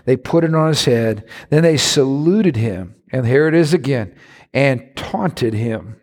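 ordinary delivery

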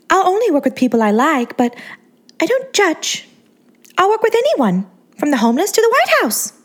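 put-on high, sweet baby-doll voice; Angeline's girly voice. A narrator's reading. To the narrator, a high, sweet starlet voice.

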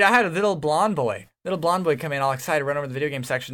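ordinary delivery

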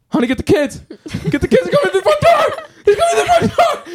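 hammed-up movie panic; mock panicked screaming. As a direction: shriek the warning through laughter. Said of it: "he just starts going something like".

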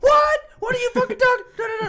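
very high, very loud mock disbelief; outraged Dwight Howard. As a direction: whine like a player protesting a foul. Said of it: "And he'd be like".

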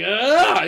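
high loud mock war cry; a sudden barbarian shout; jumping out with a trash can; mock battle yell. Retold trash-can attack yell.

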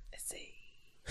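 whispered letters; whispering. Real, not acted. Finishing spelled-out name.

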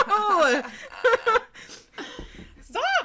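shrieking with laughter, very high; scream-laugh in protest. Real, not acted. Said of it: "You're screaming too much".

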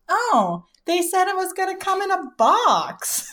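high mock voice of the mom; imitating mom. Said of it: "she was like".

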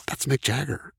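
staring at a rock star, murmured in awe; hushed awe. Retold or imitated. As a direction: say it under your breath, starstruck. Starstruck aside to each other.

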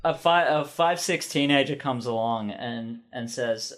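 ordinary talk

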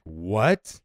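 comic outraged double take; mock-incredulous. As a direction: play up the disbelief, voice shooting upward. Husband balks at opening the bottle.

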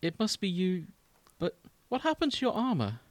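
high, earnest princess voice; lightly voiced noble princess. Plays Zelda in read-through.

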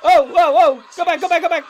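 high, loud shouts at the video; excited cheering. Real, not acted. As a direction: cheer the jumps like a fan.

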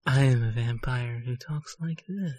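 quiet, eerie vampire murmur; the second kind of vampire, hushed; soft vampire voice. Shown as a demonstration. Friend asked to do the other vampire voice. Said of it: "you have to do it".

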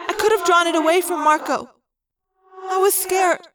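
put-on young girl confessing fear; Cassie, scared. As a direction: admit the fear, voice lifting.

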